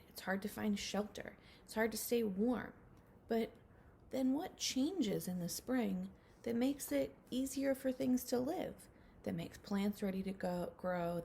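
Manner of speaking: usual speaking voice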